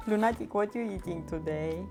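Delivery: usual speaking voice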